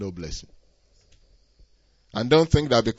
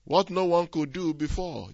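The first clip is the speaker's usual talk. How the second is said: ordinary delivery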